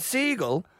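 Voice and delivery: "seagull" said slowly in an Irish accent. Shown as a demonstration. Irish comedian showing how he says "seagull", slow Irish accent. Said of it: "that's my Irish accent saying seagull".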